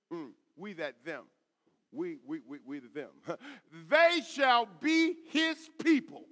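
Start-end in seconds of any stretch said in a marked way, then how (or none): 3.9-6.3 loud, high, slow scripture proclamation; forceful preaching. Real, not acted. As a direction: proclaim each word from the pulpit.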